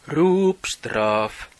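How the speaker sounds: high, loud terrace call; fan's penalty shout. Shown as a demonstration. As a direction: call it out like a supporter. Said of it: "brukar man ropa".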